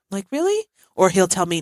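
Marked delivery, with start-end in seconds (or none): 0.3-0.6 high-pitched mock disbelief; incredulous. Mother answering her know-it-all son, incredulous. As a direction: question it in a high, doubting squeak.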